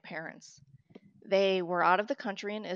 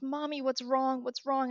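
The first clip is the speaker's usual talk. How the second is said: lightly voiced worried daughter, higher and drawn out; worried child; 7 semitones higher than usual. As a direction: imitate a child anxiously asking mother. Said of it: "she sees me crying and she goes".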